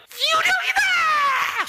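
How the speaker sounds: very high, drawn-out scream; slow terrified yell; terrified shout. Acted. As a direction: scream like seeing a ghost. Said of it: "소리 지르는 겁니다".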